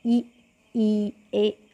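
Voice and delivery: ई ई ए each vowel said clearly and held; vowel demonstration. Shown as a demonstration. Teacher demonstrates front vowels to students, clearly sounded. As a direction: sound out ई and ए slowly for the class. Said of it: "इन शब्दों को बोलकर देखें".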